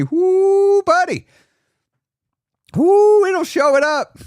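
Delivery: high, loud drawn-out whoo; excited whoop. Real, not acted. Host to viewers, a loud high excited whoo. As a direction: let the whoo fly up high.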